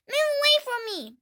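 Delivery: high, loud duckling cry; a duckling shouting at its brother; cried. Acted. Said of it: "cried one".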